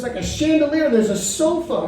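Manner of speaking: ordinary talk, nothing marked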